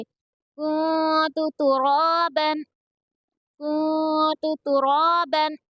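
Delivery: "कुंतु तुराबन" slowly, the N of कुंतु a held nasal and रा drawn out; "कुंतु तुराबन" with nun hidden and vowel stretched; stretched recitation. Shown as a demonstration. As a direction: chant "कुंतु तुराबन" with a long रा. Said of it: "इसको थोड़ा खींच कर पढ़ेंगे".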